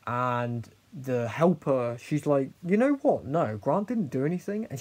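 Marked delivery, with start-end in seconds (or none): 2.6-4.7 high, loud mock indignation; indignant defence. Helper standing up for a bullied pupil, indignant. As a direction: imitate someone speaking up in protest, pitch jumping up.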